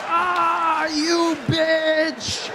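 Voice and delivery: high, loud mock scream; long outraged yell; cursing scream. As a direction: shriek the curse in hammed-up panic. Retold sight of fifty cars.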